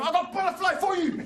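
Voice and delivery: high-pitched mock brawler's threat; hammed-up fighting taunt; street fighter. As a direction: imitate a dude squaring up in the street. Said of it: "couple of dudes fighting in the street".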